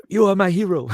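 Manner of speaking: put-on high, loud Sudanese accent; African accent. Grateful parking-lot friend to his rescuer, loud high Sudanese accent. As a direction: exclaim it in an imitated Sudanese accent. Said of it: "I'll do his accent".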